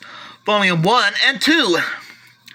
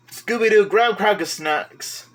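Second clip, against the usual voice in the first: ordinary talk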